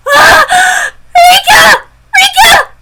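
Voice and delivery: high sobbing screams of a name; anguished scream. Acted. Girl watching her friend shot, sobbing screams. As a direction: scream for your dying friend.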